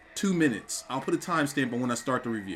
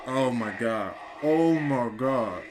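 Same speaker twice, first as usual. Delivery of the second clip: stunned disbelief; much slower than usual. Real, not acted. Stunned reaction to match.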